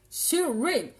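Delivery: English product names with a showy, exaggerated accent; show-off English accent. [name] showing viewers her blush names, showy English accent. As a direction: draw out the English words in a loud, high put-on accent. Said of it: "vous avez vu un peu l'accent que j'ai?".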